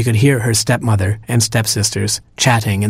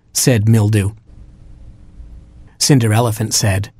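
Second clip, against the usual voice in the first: ordinary delivery, nothing marked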